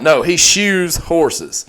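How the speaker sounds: ordinary talk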